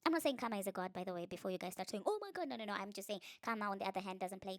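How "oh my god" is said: high-pitched imitated gasp of shock; mock outrage. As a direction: mimic a scandalized viewer, pitched way up. Pre-empting viewers' outraged reaction.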